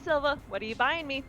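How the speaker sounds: ordinary delivery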